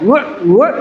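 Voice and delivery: a retching gag sound; gagging noise, retold; imitated retching. Retching while lying sick.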